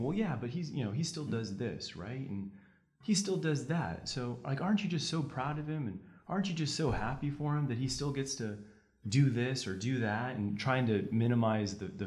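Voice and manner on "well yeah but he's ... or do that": high, syrupy fake-sweet questioning; mock the defense lawyer's sweet little questions; sugary mock lawyer. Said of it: "like, saying".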